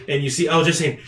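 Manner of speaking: ordinary talk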